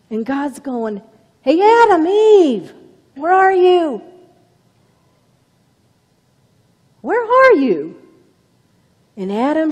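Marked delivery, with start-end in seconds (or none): 1.4-8.0 high, loud call across the garden; God calling loudly to the hiding pair. Acted. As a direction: shout out searching for them. God calling for hidden Adam.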